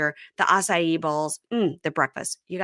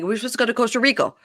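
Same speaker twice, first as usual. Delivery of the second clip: rushed retelling; 4 semitones higher, much faster than usual. Retold or imitated. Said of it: "when he kept saying".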